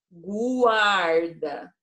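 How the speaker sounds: "guarda" esticado, com o U bem audível depois do G; U pronunciado. Shown as a demonstration. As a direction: separar o U em "guarda": gu-ar-da. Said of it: "eu estou falando U". (o U de "guarda" bem pronunciado)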